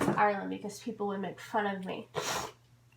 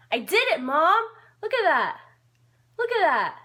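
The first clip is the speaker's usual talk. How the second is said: real triumphant cry, higher and louder; excited triumph; 9 semitones higher, 9 dB louder than usual. Real, not acted. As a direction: shout it out proud to mom. Proud success, calling to mom.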